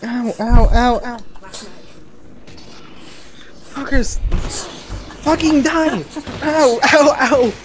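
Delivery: high, loud pained yelps; panicking gamer. Real, not acted. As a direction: yelp in panic under fire. Getting shot in game.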